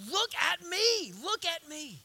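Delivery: high, insistent preacher's call; urgent command, easing on the repeat; forceful command. Acted. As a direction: raise it up and stretch it out. God's command at full force.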